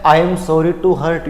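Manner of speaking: "I am sorry to hurt you" dragged out slowly; teacher imitating how people apologise, slowly; slow imitation of common usage. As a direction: show how everyone says it, slowly. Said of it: "India में सब लोग sorry कैसे बोलते हैं".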